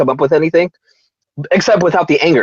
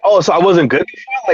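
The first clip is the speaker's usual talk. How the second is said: put-on drunk talk; 3 semitones higher than usual. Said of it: "that's total drunk talk right there".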